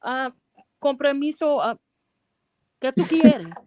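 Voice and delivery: a touch of a wary resident at the door; Spanish-speaking resident. Retold or imitated. Customer at door questioning repairwoman, in Spanish. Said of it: "they're like".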